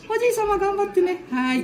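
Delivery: falsetto voice flipping up; squeaky cracking child voice. Acted. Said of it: "声が裏返ってるぞ".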